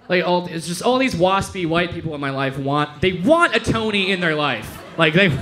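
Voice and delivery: ordinary delivery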